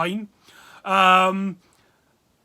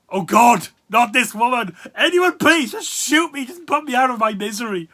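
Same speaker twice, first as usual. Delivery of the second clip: mock despair; 3 semitones higher, 6 dB louder than usual. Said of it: "So he's just like".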